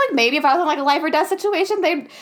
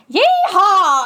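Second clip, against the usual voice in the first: loud country yeehaw, pitched up; 5 semitones higher, 5 dB louder than usual. Retold or imitated. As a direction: whoop it like a cowboy. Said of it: "first Jack goes".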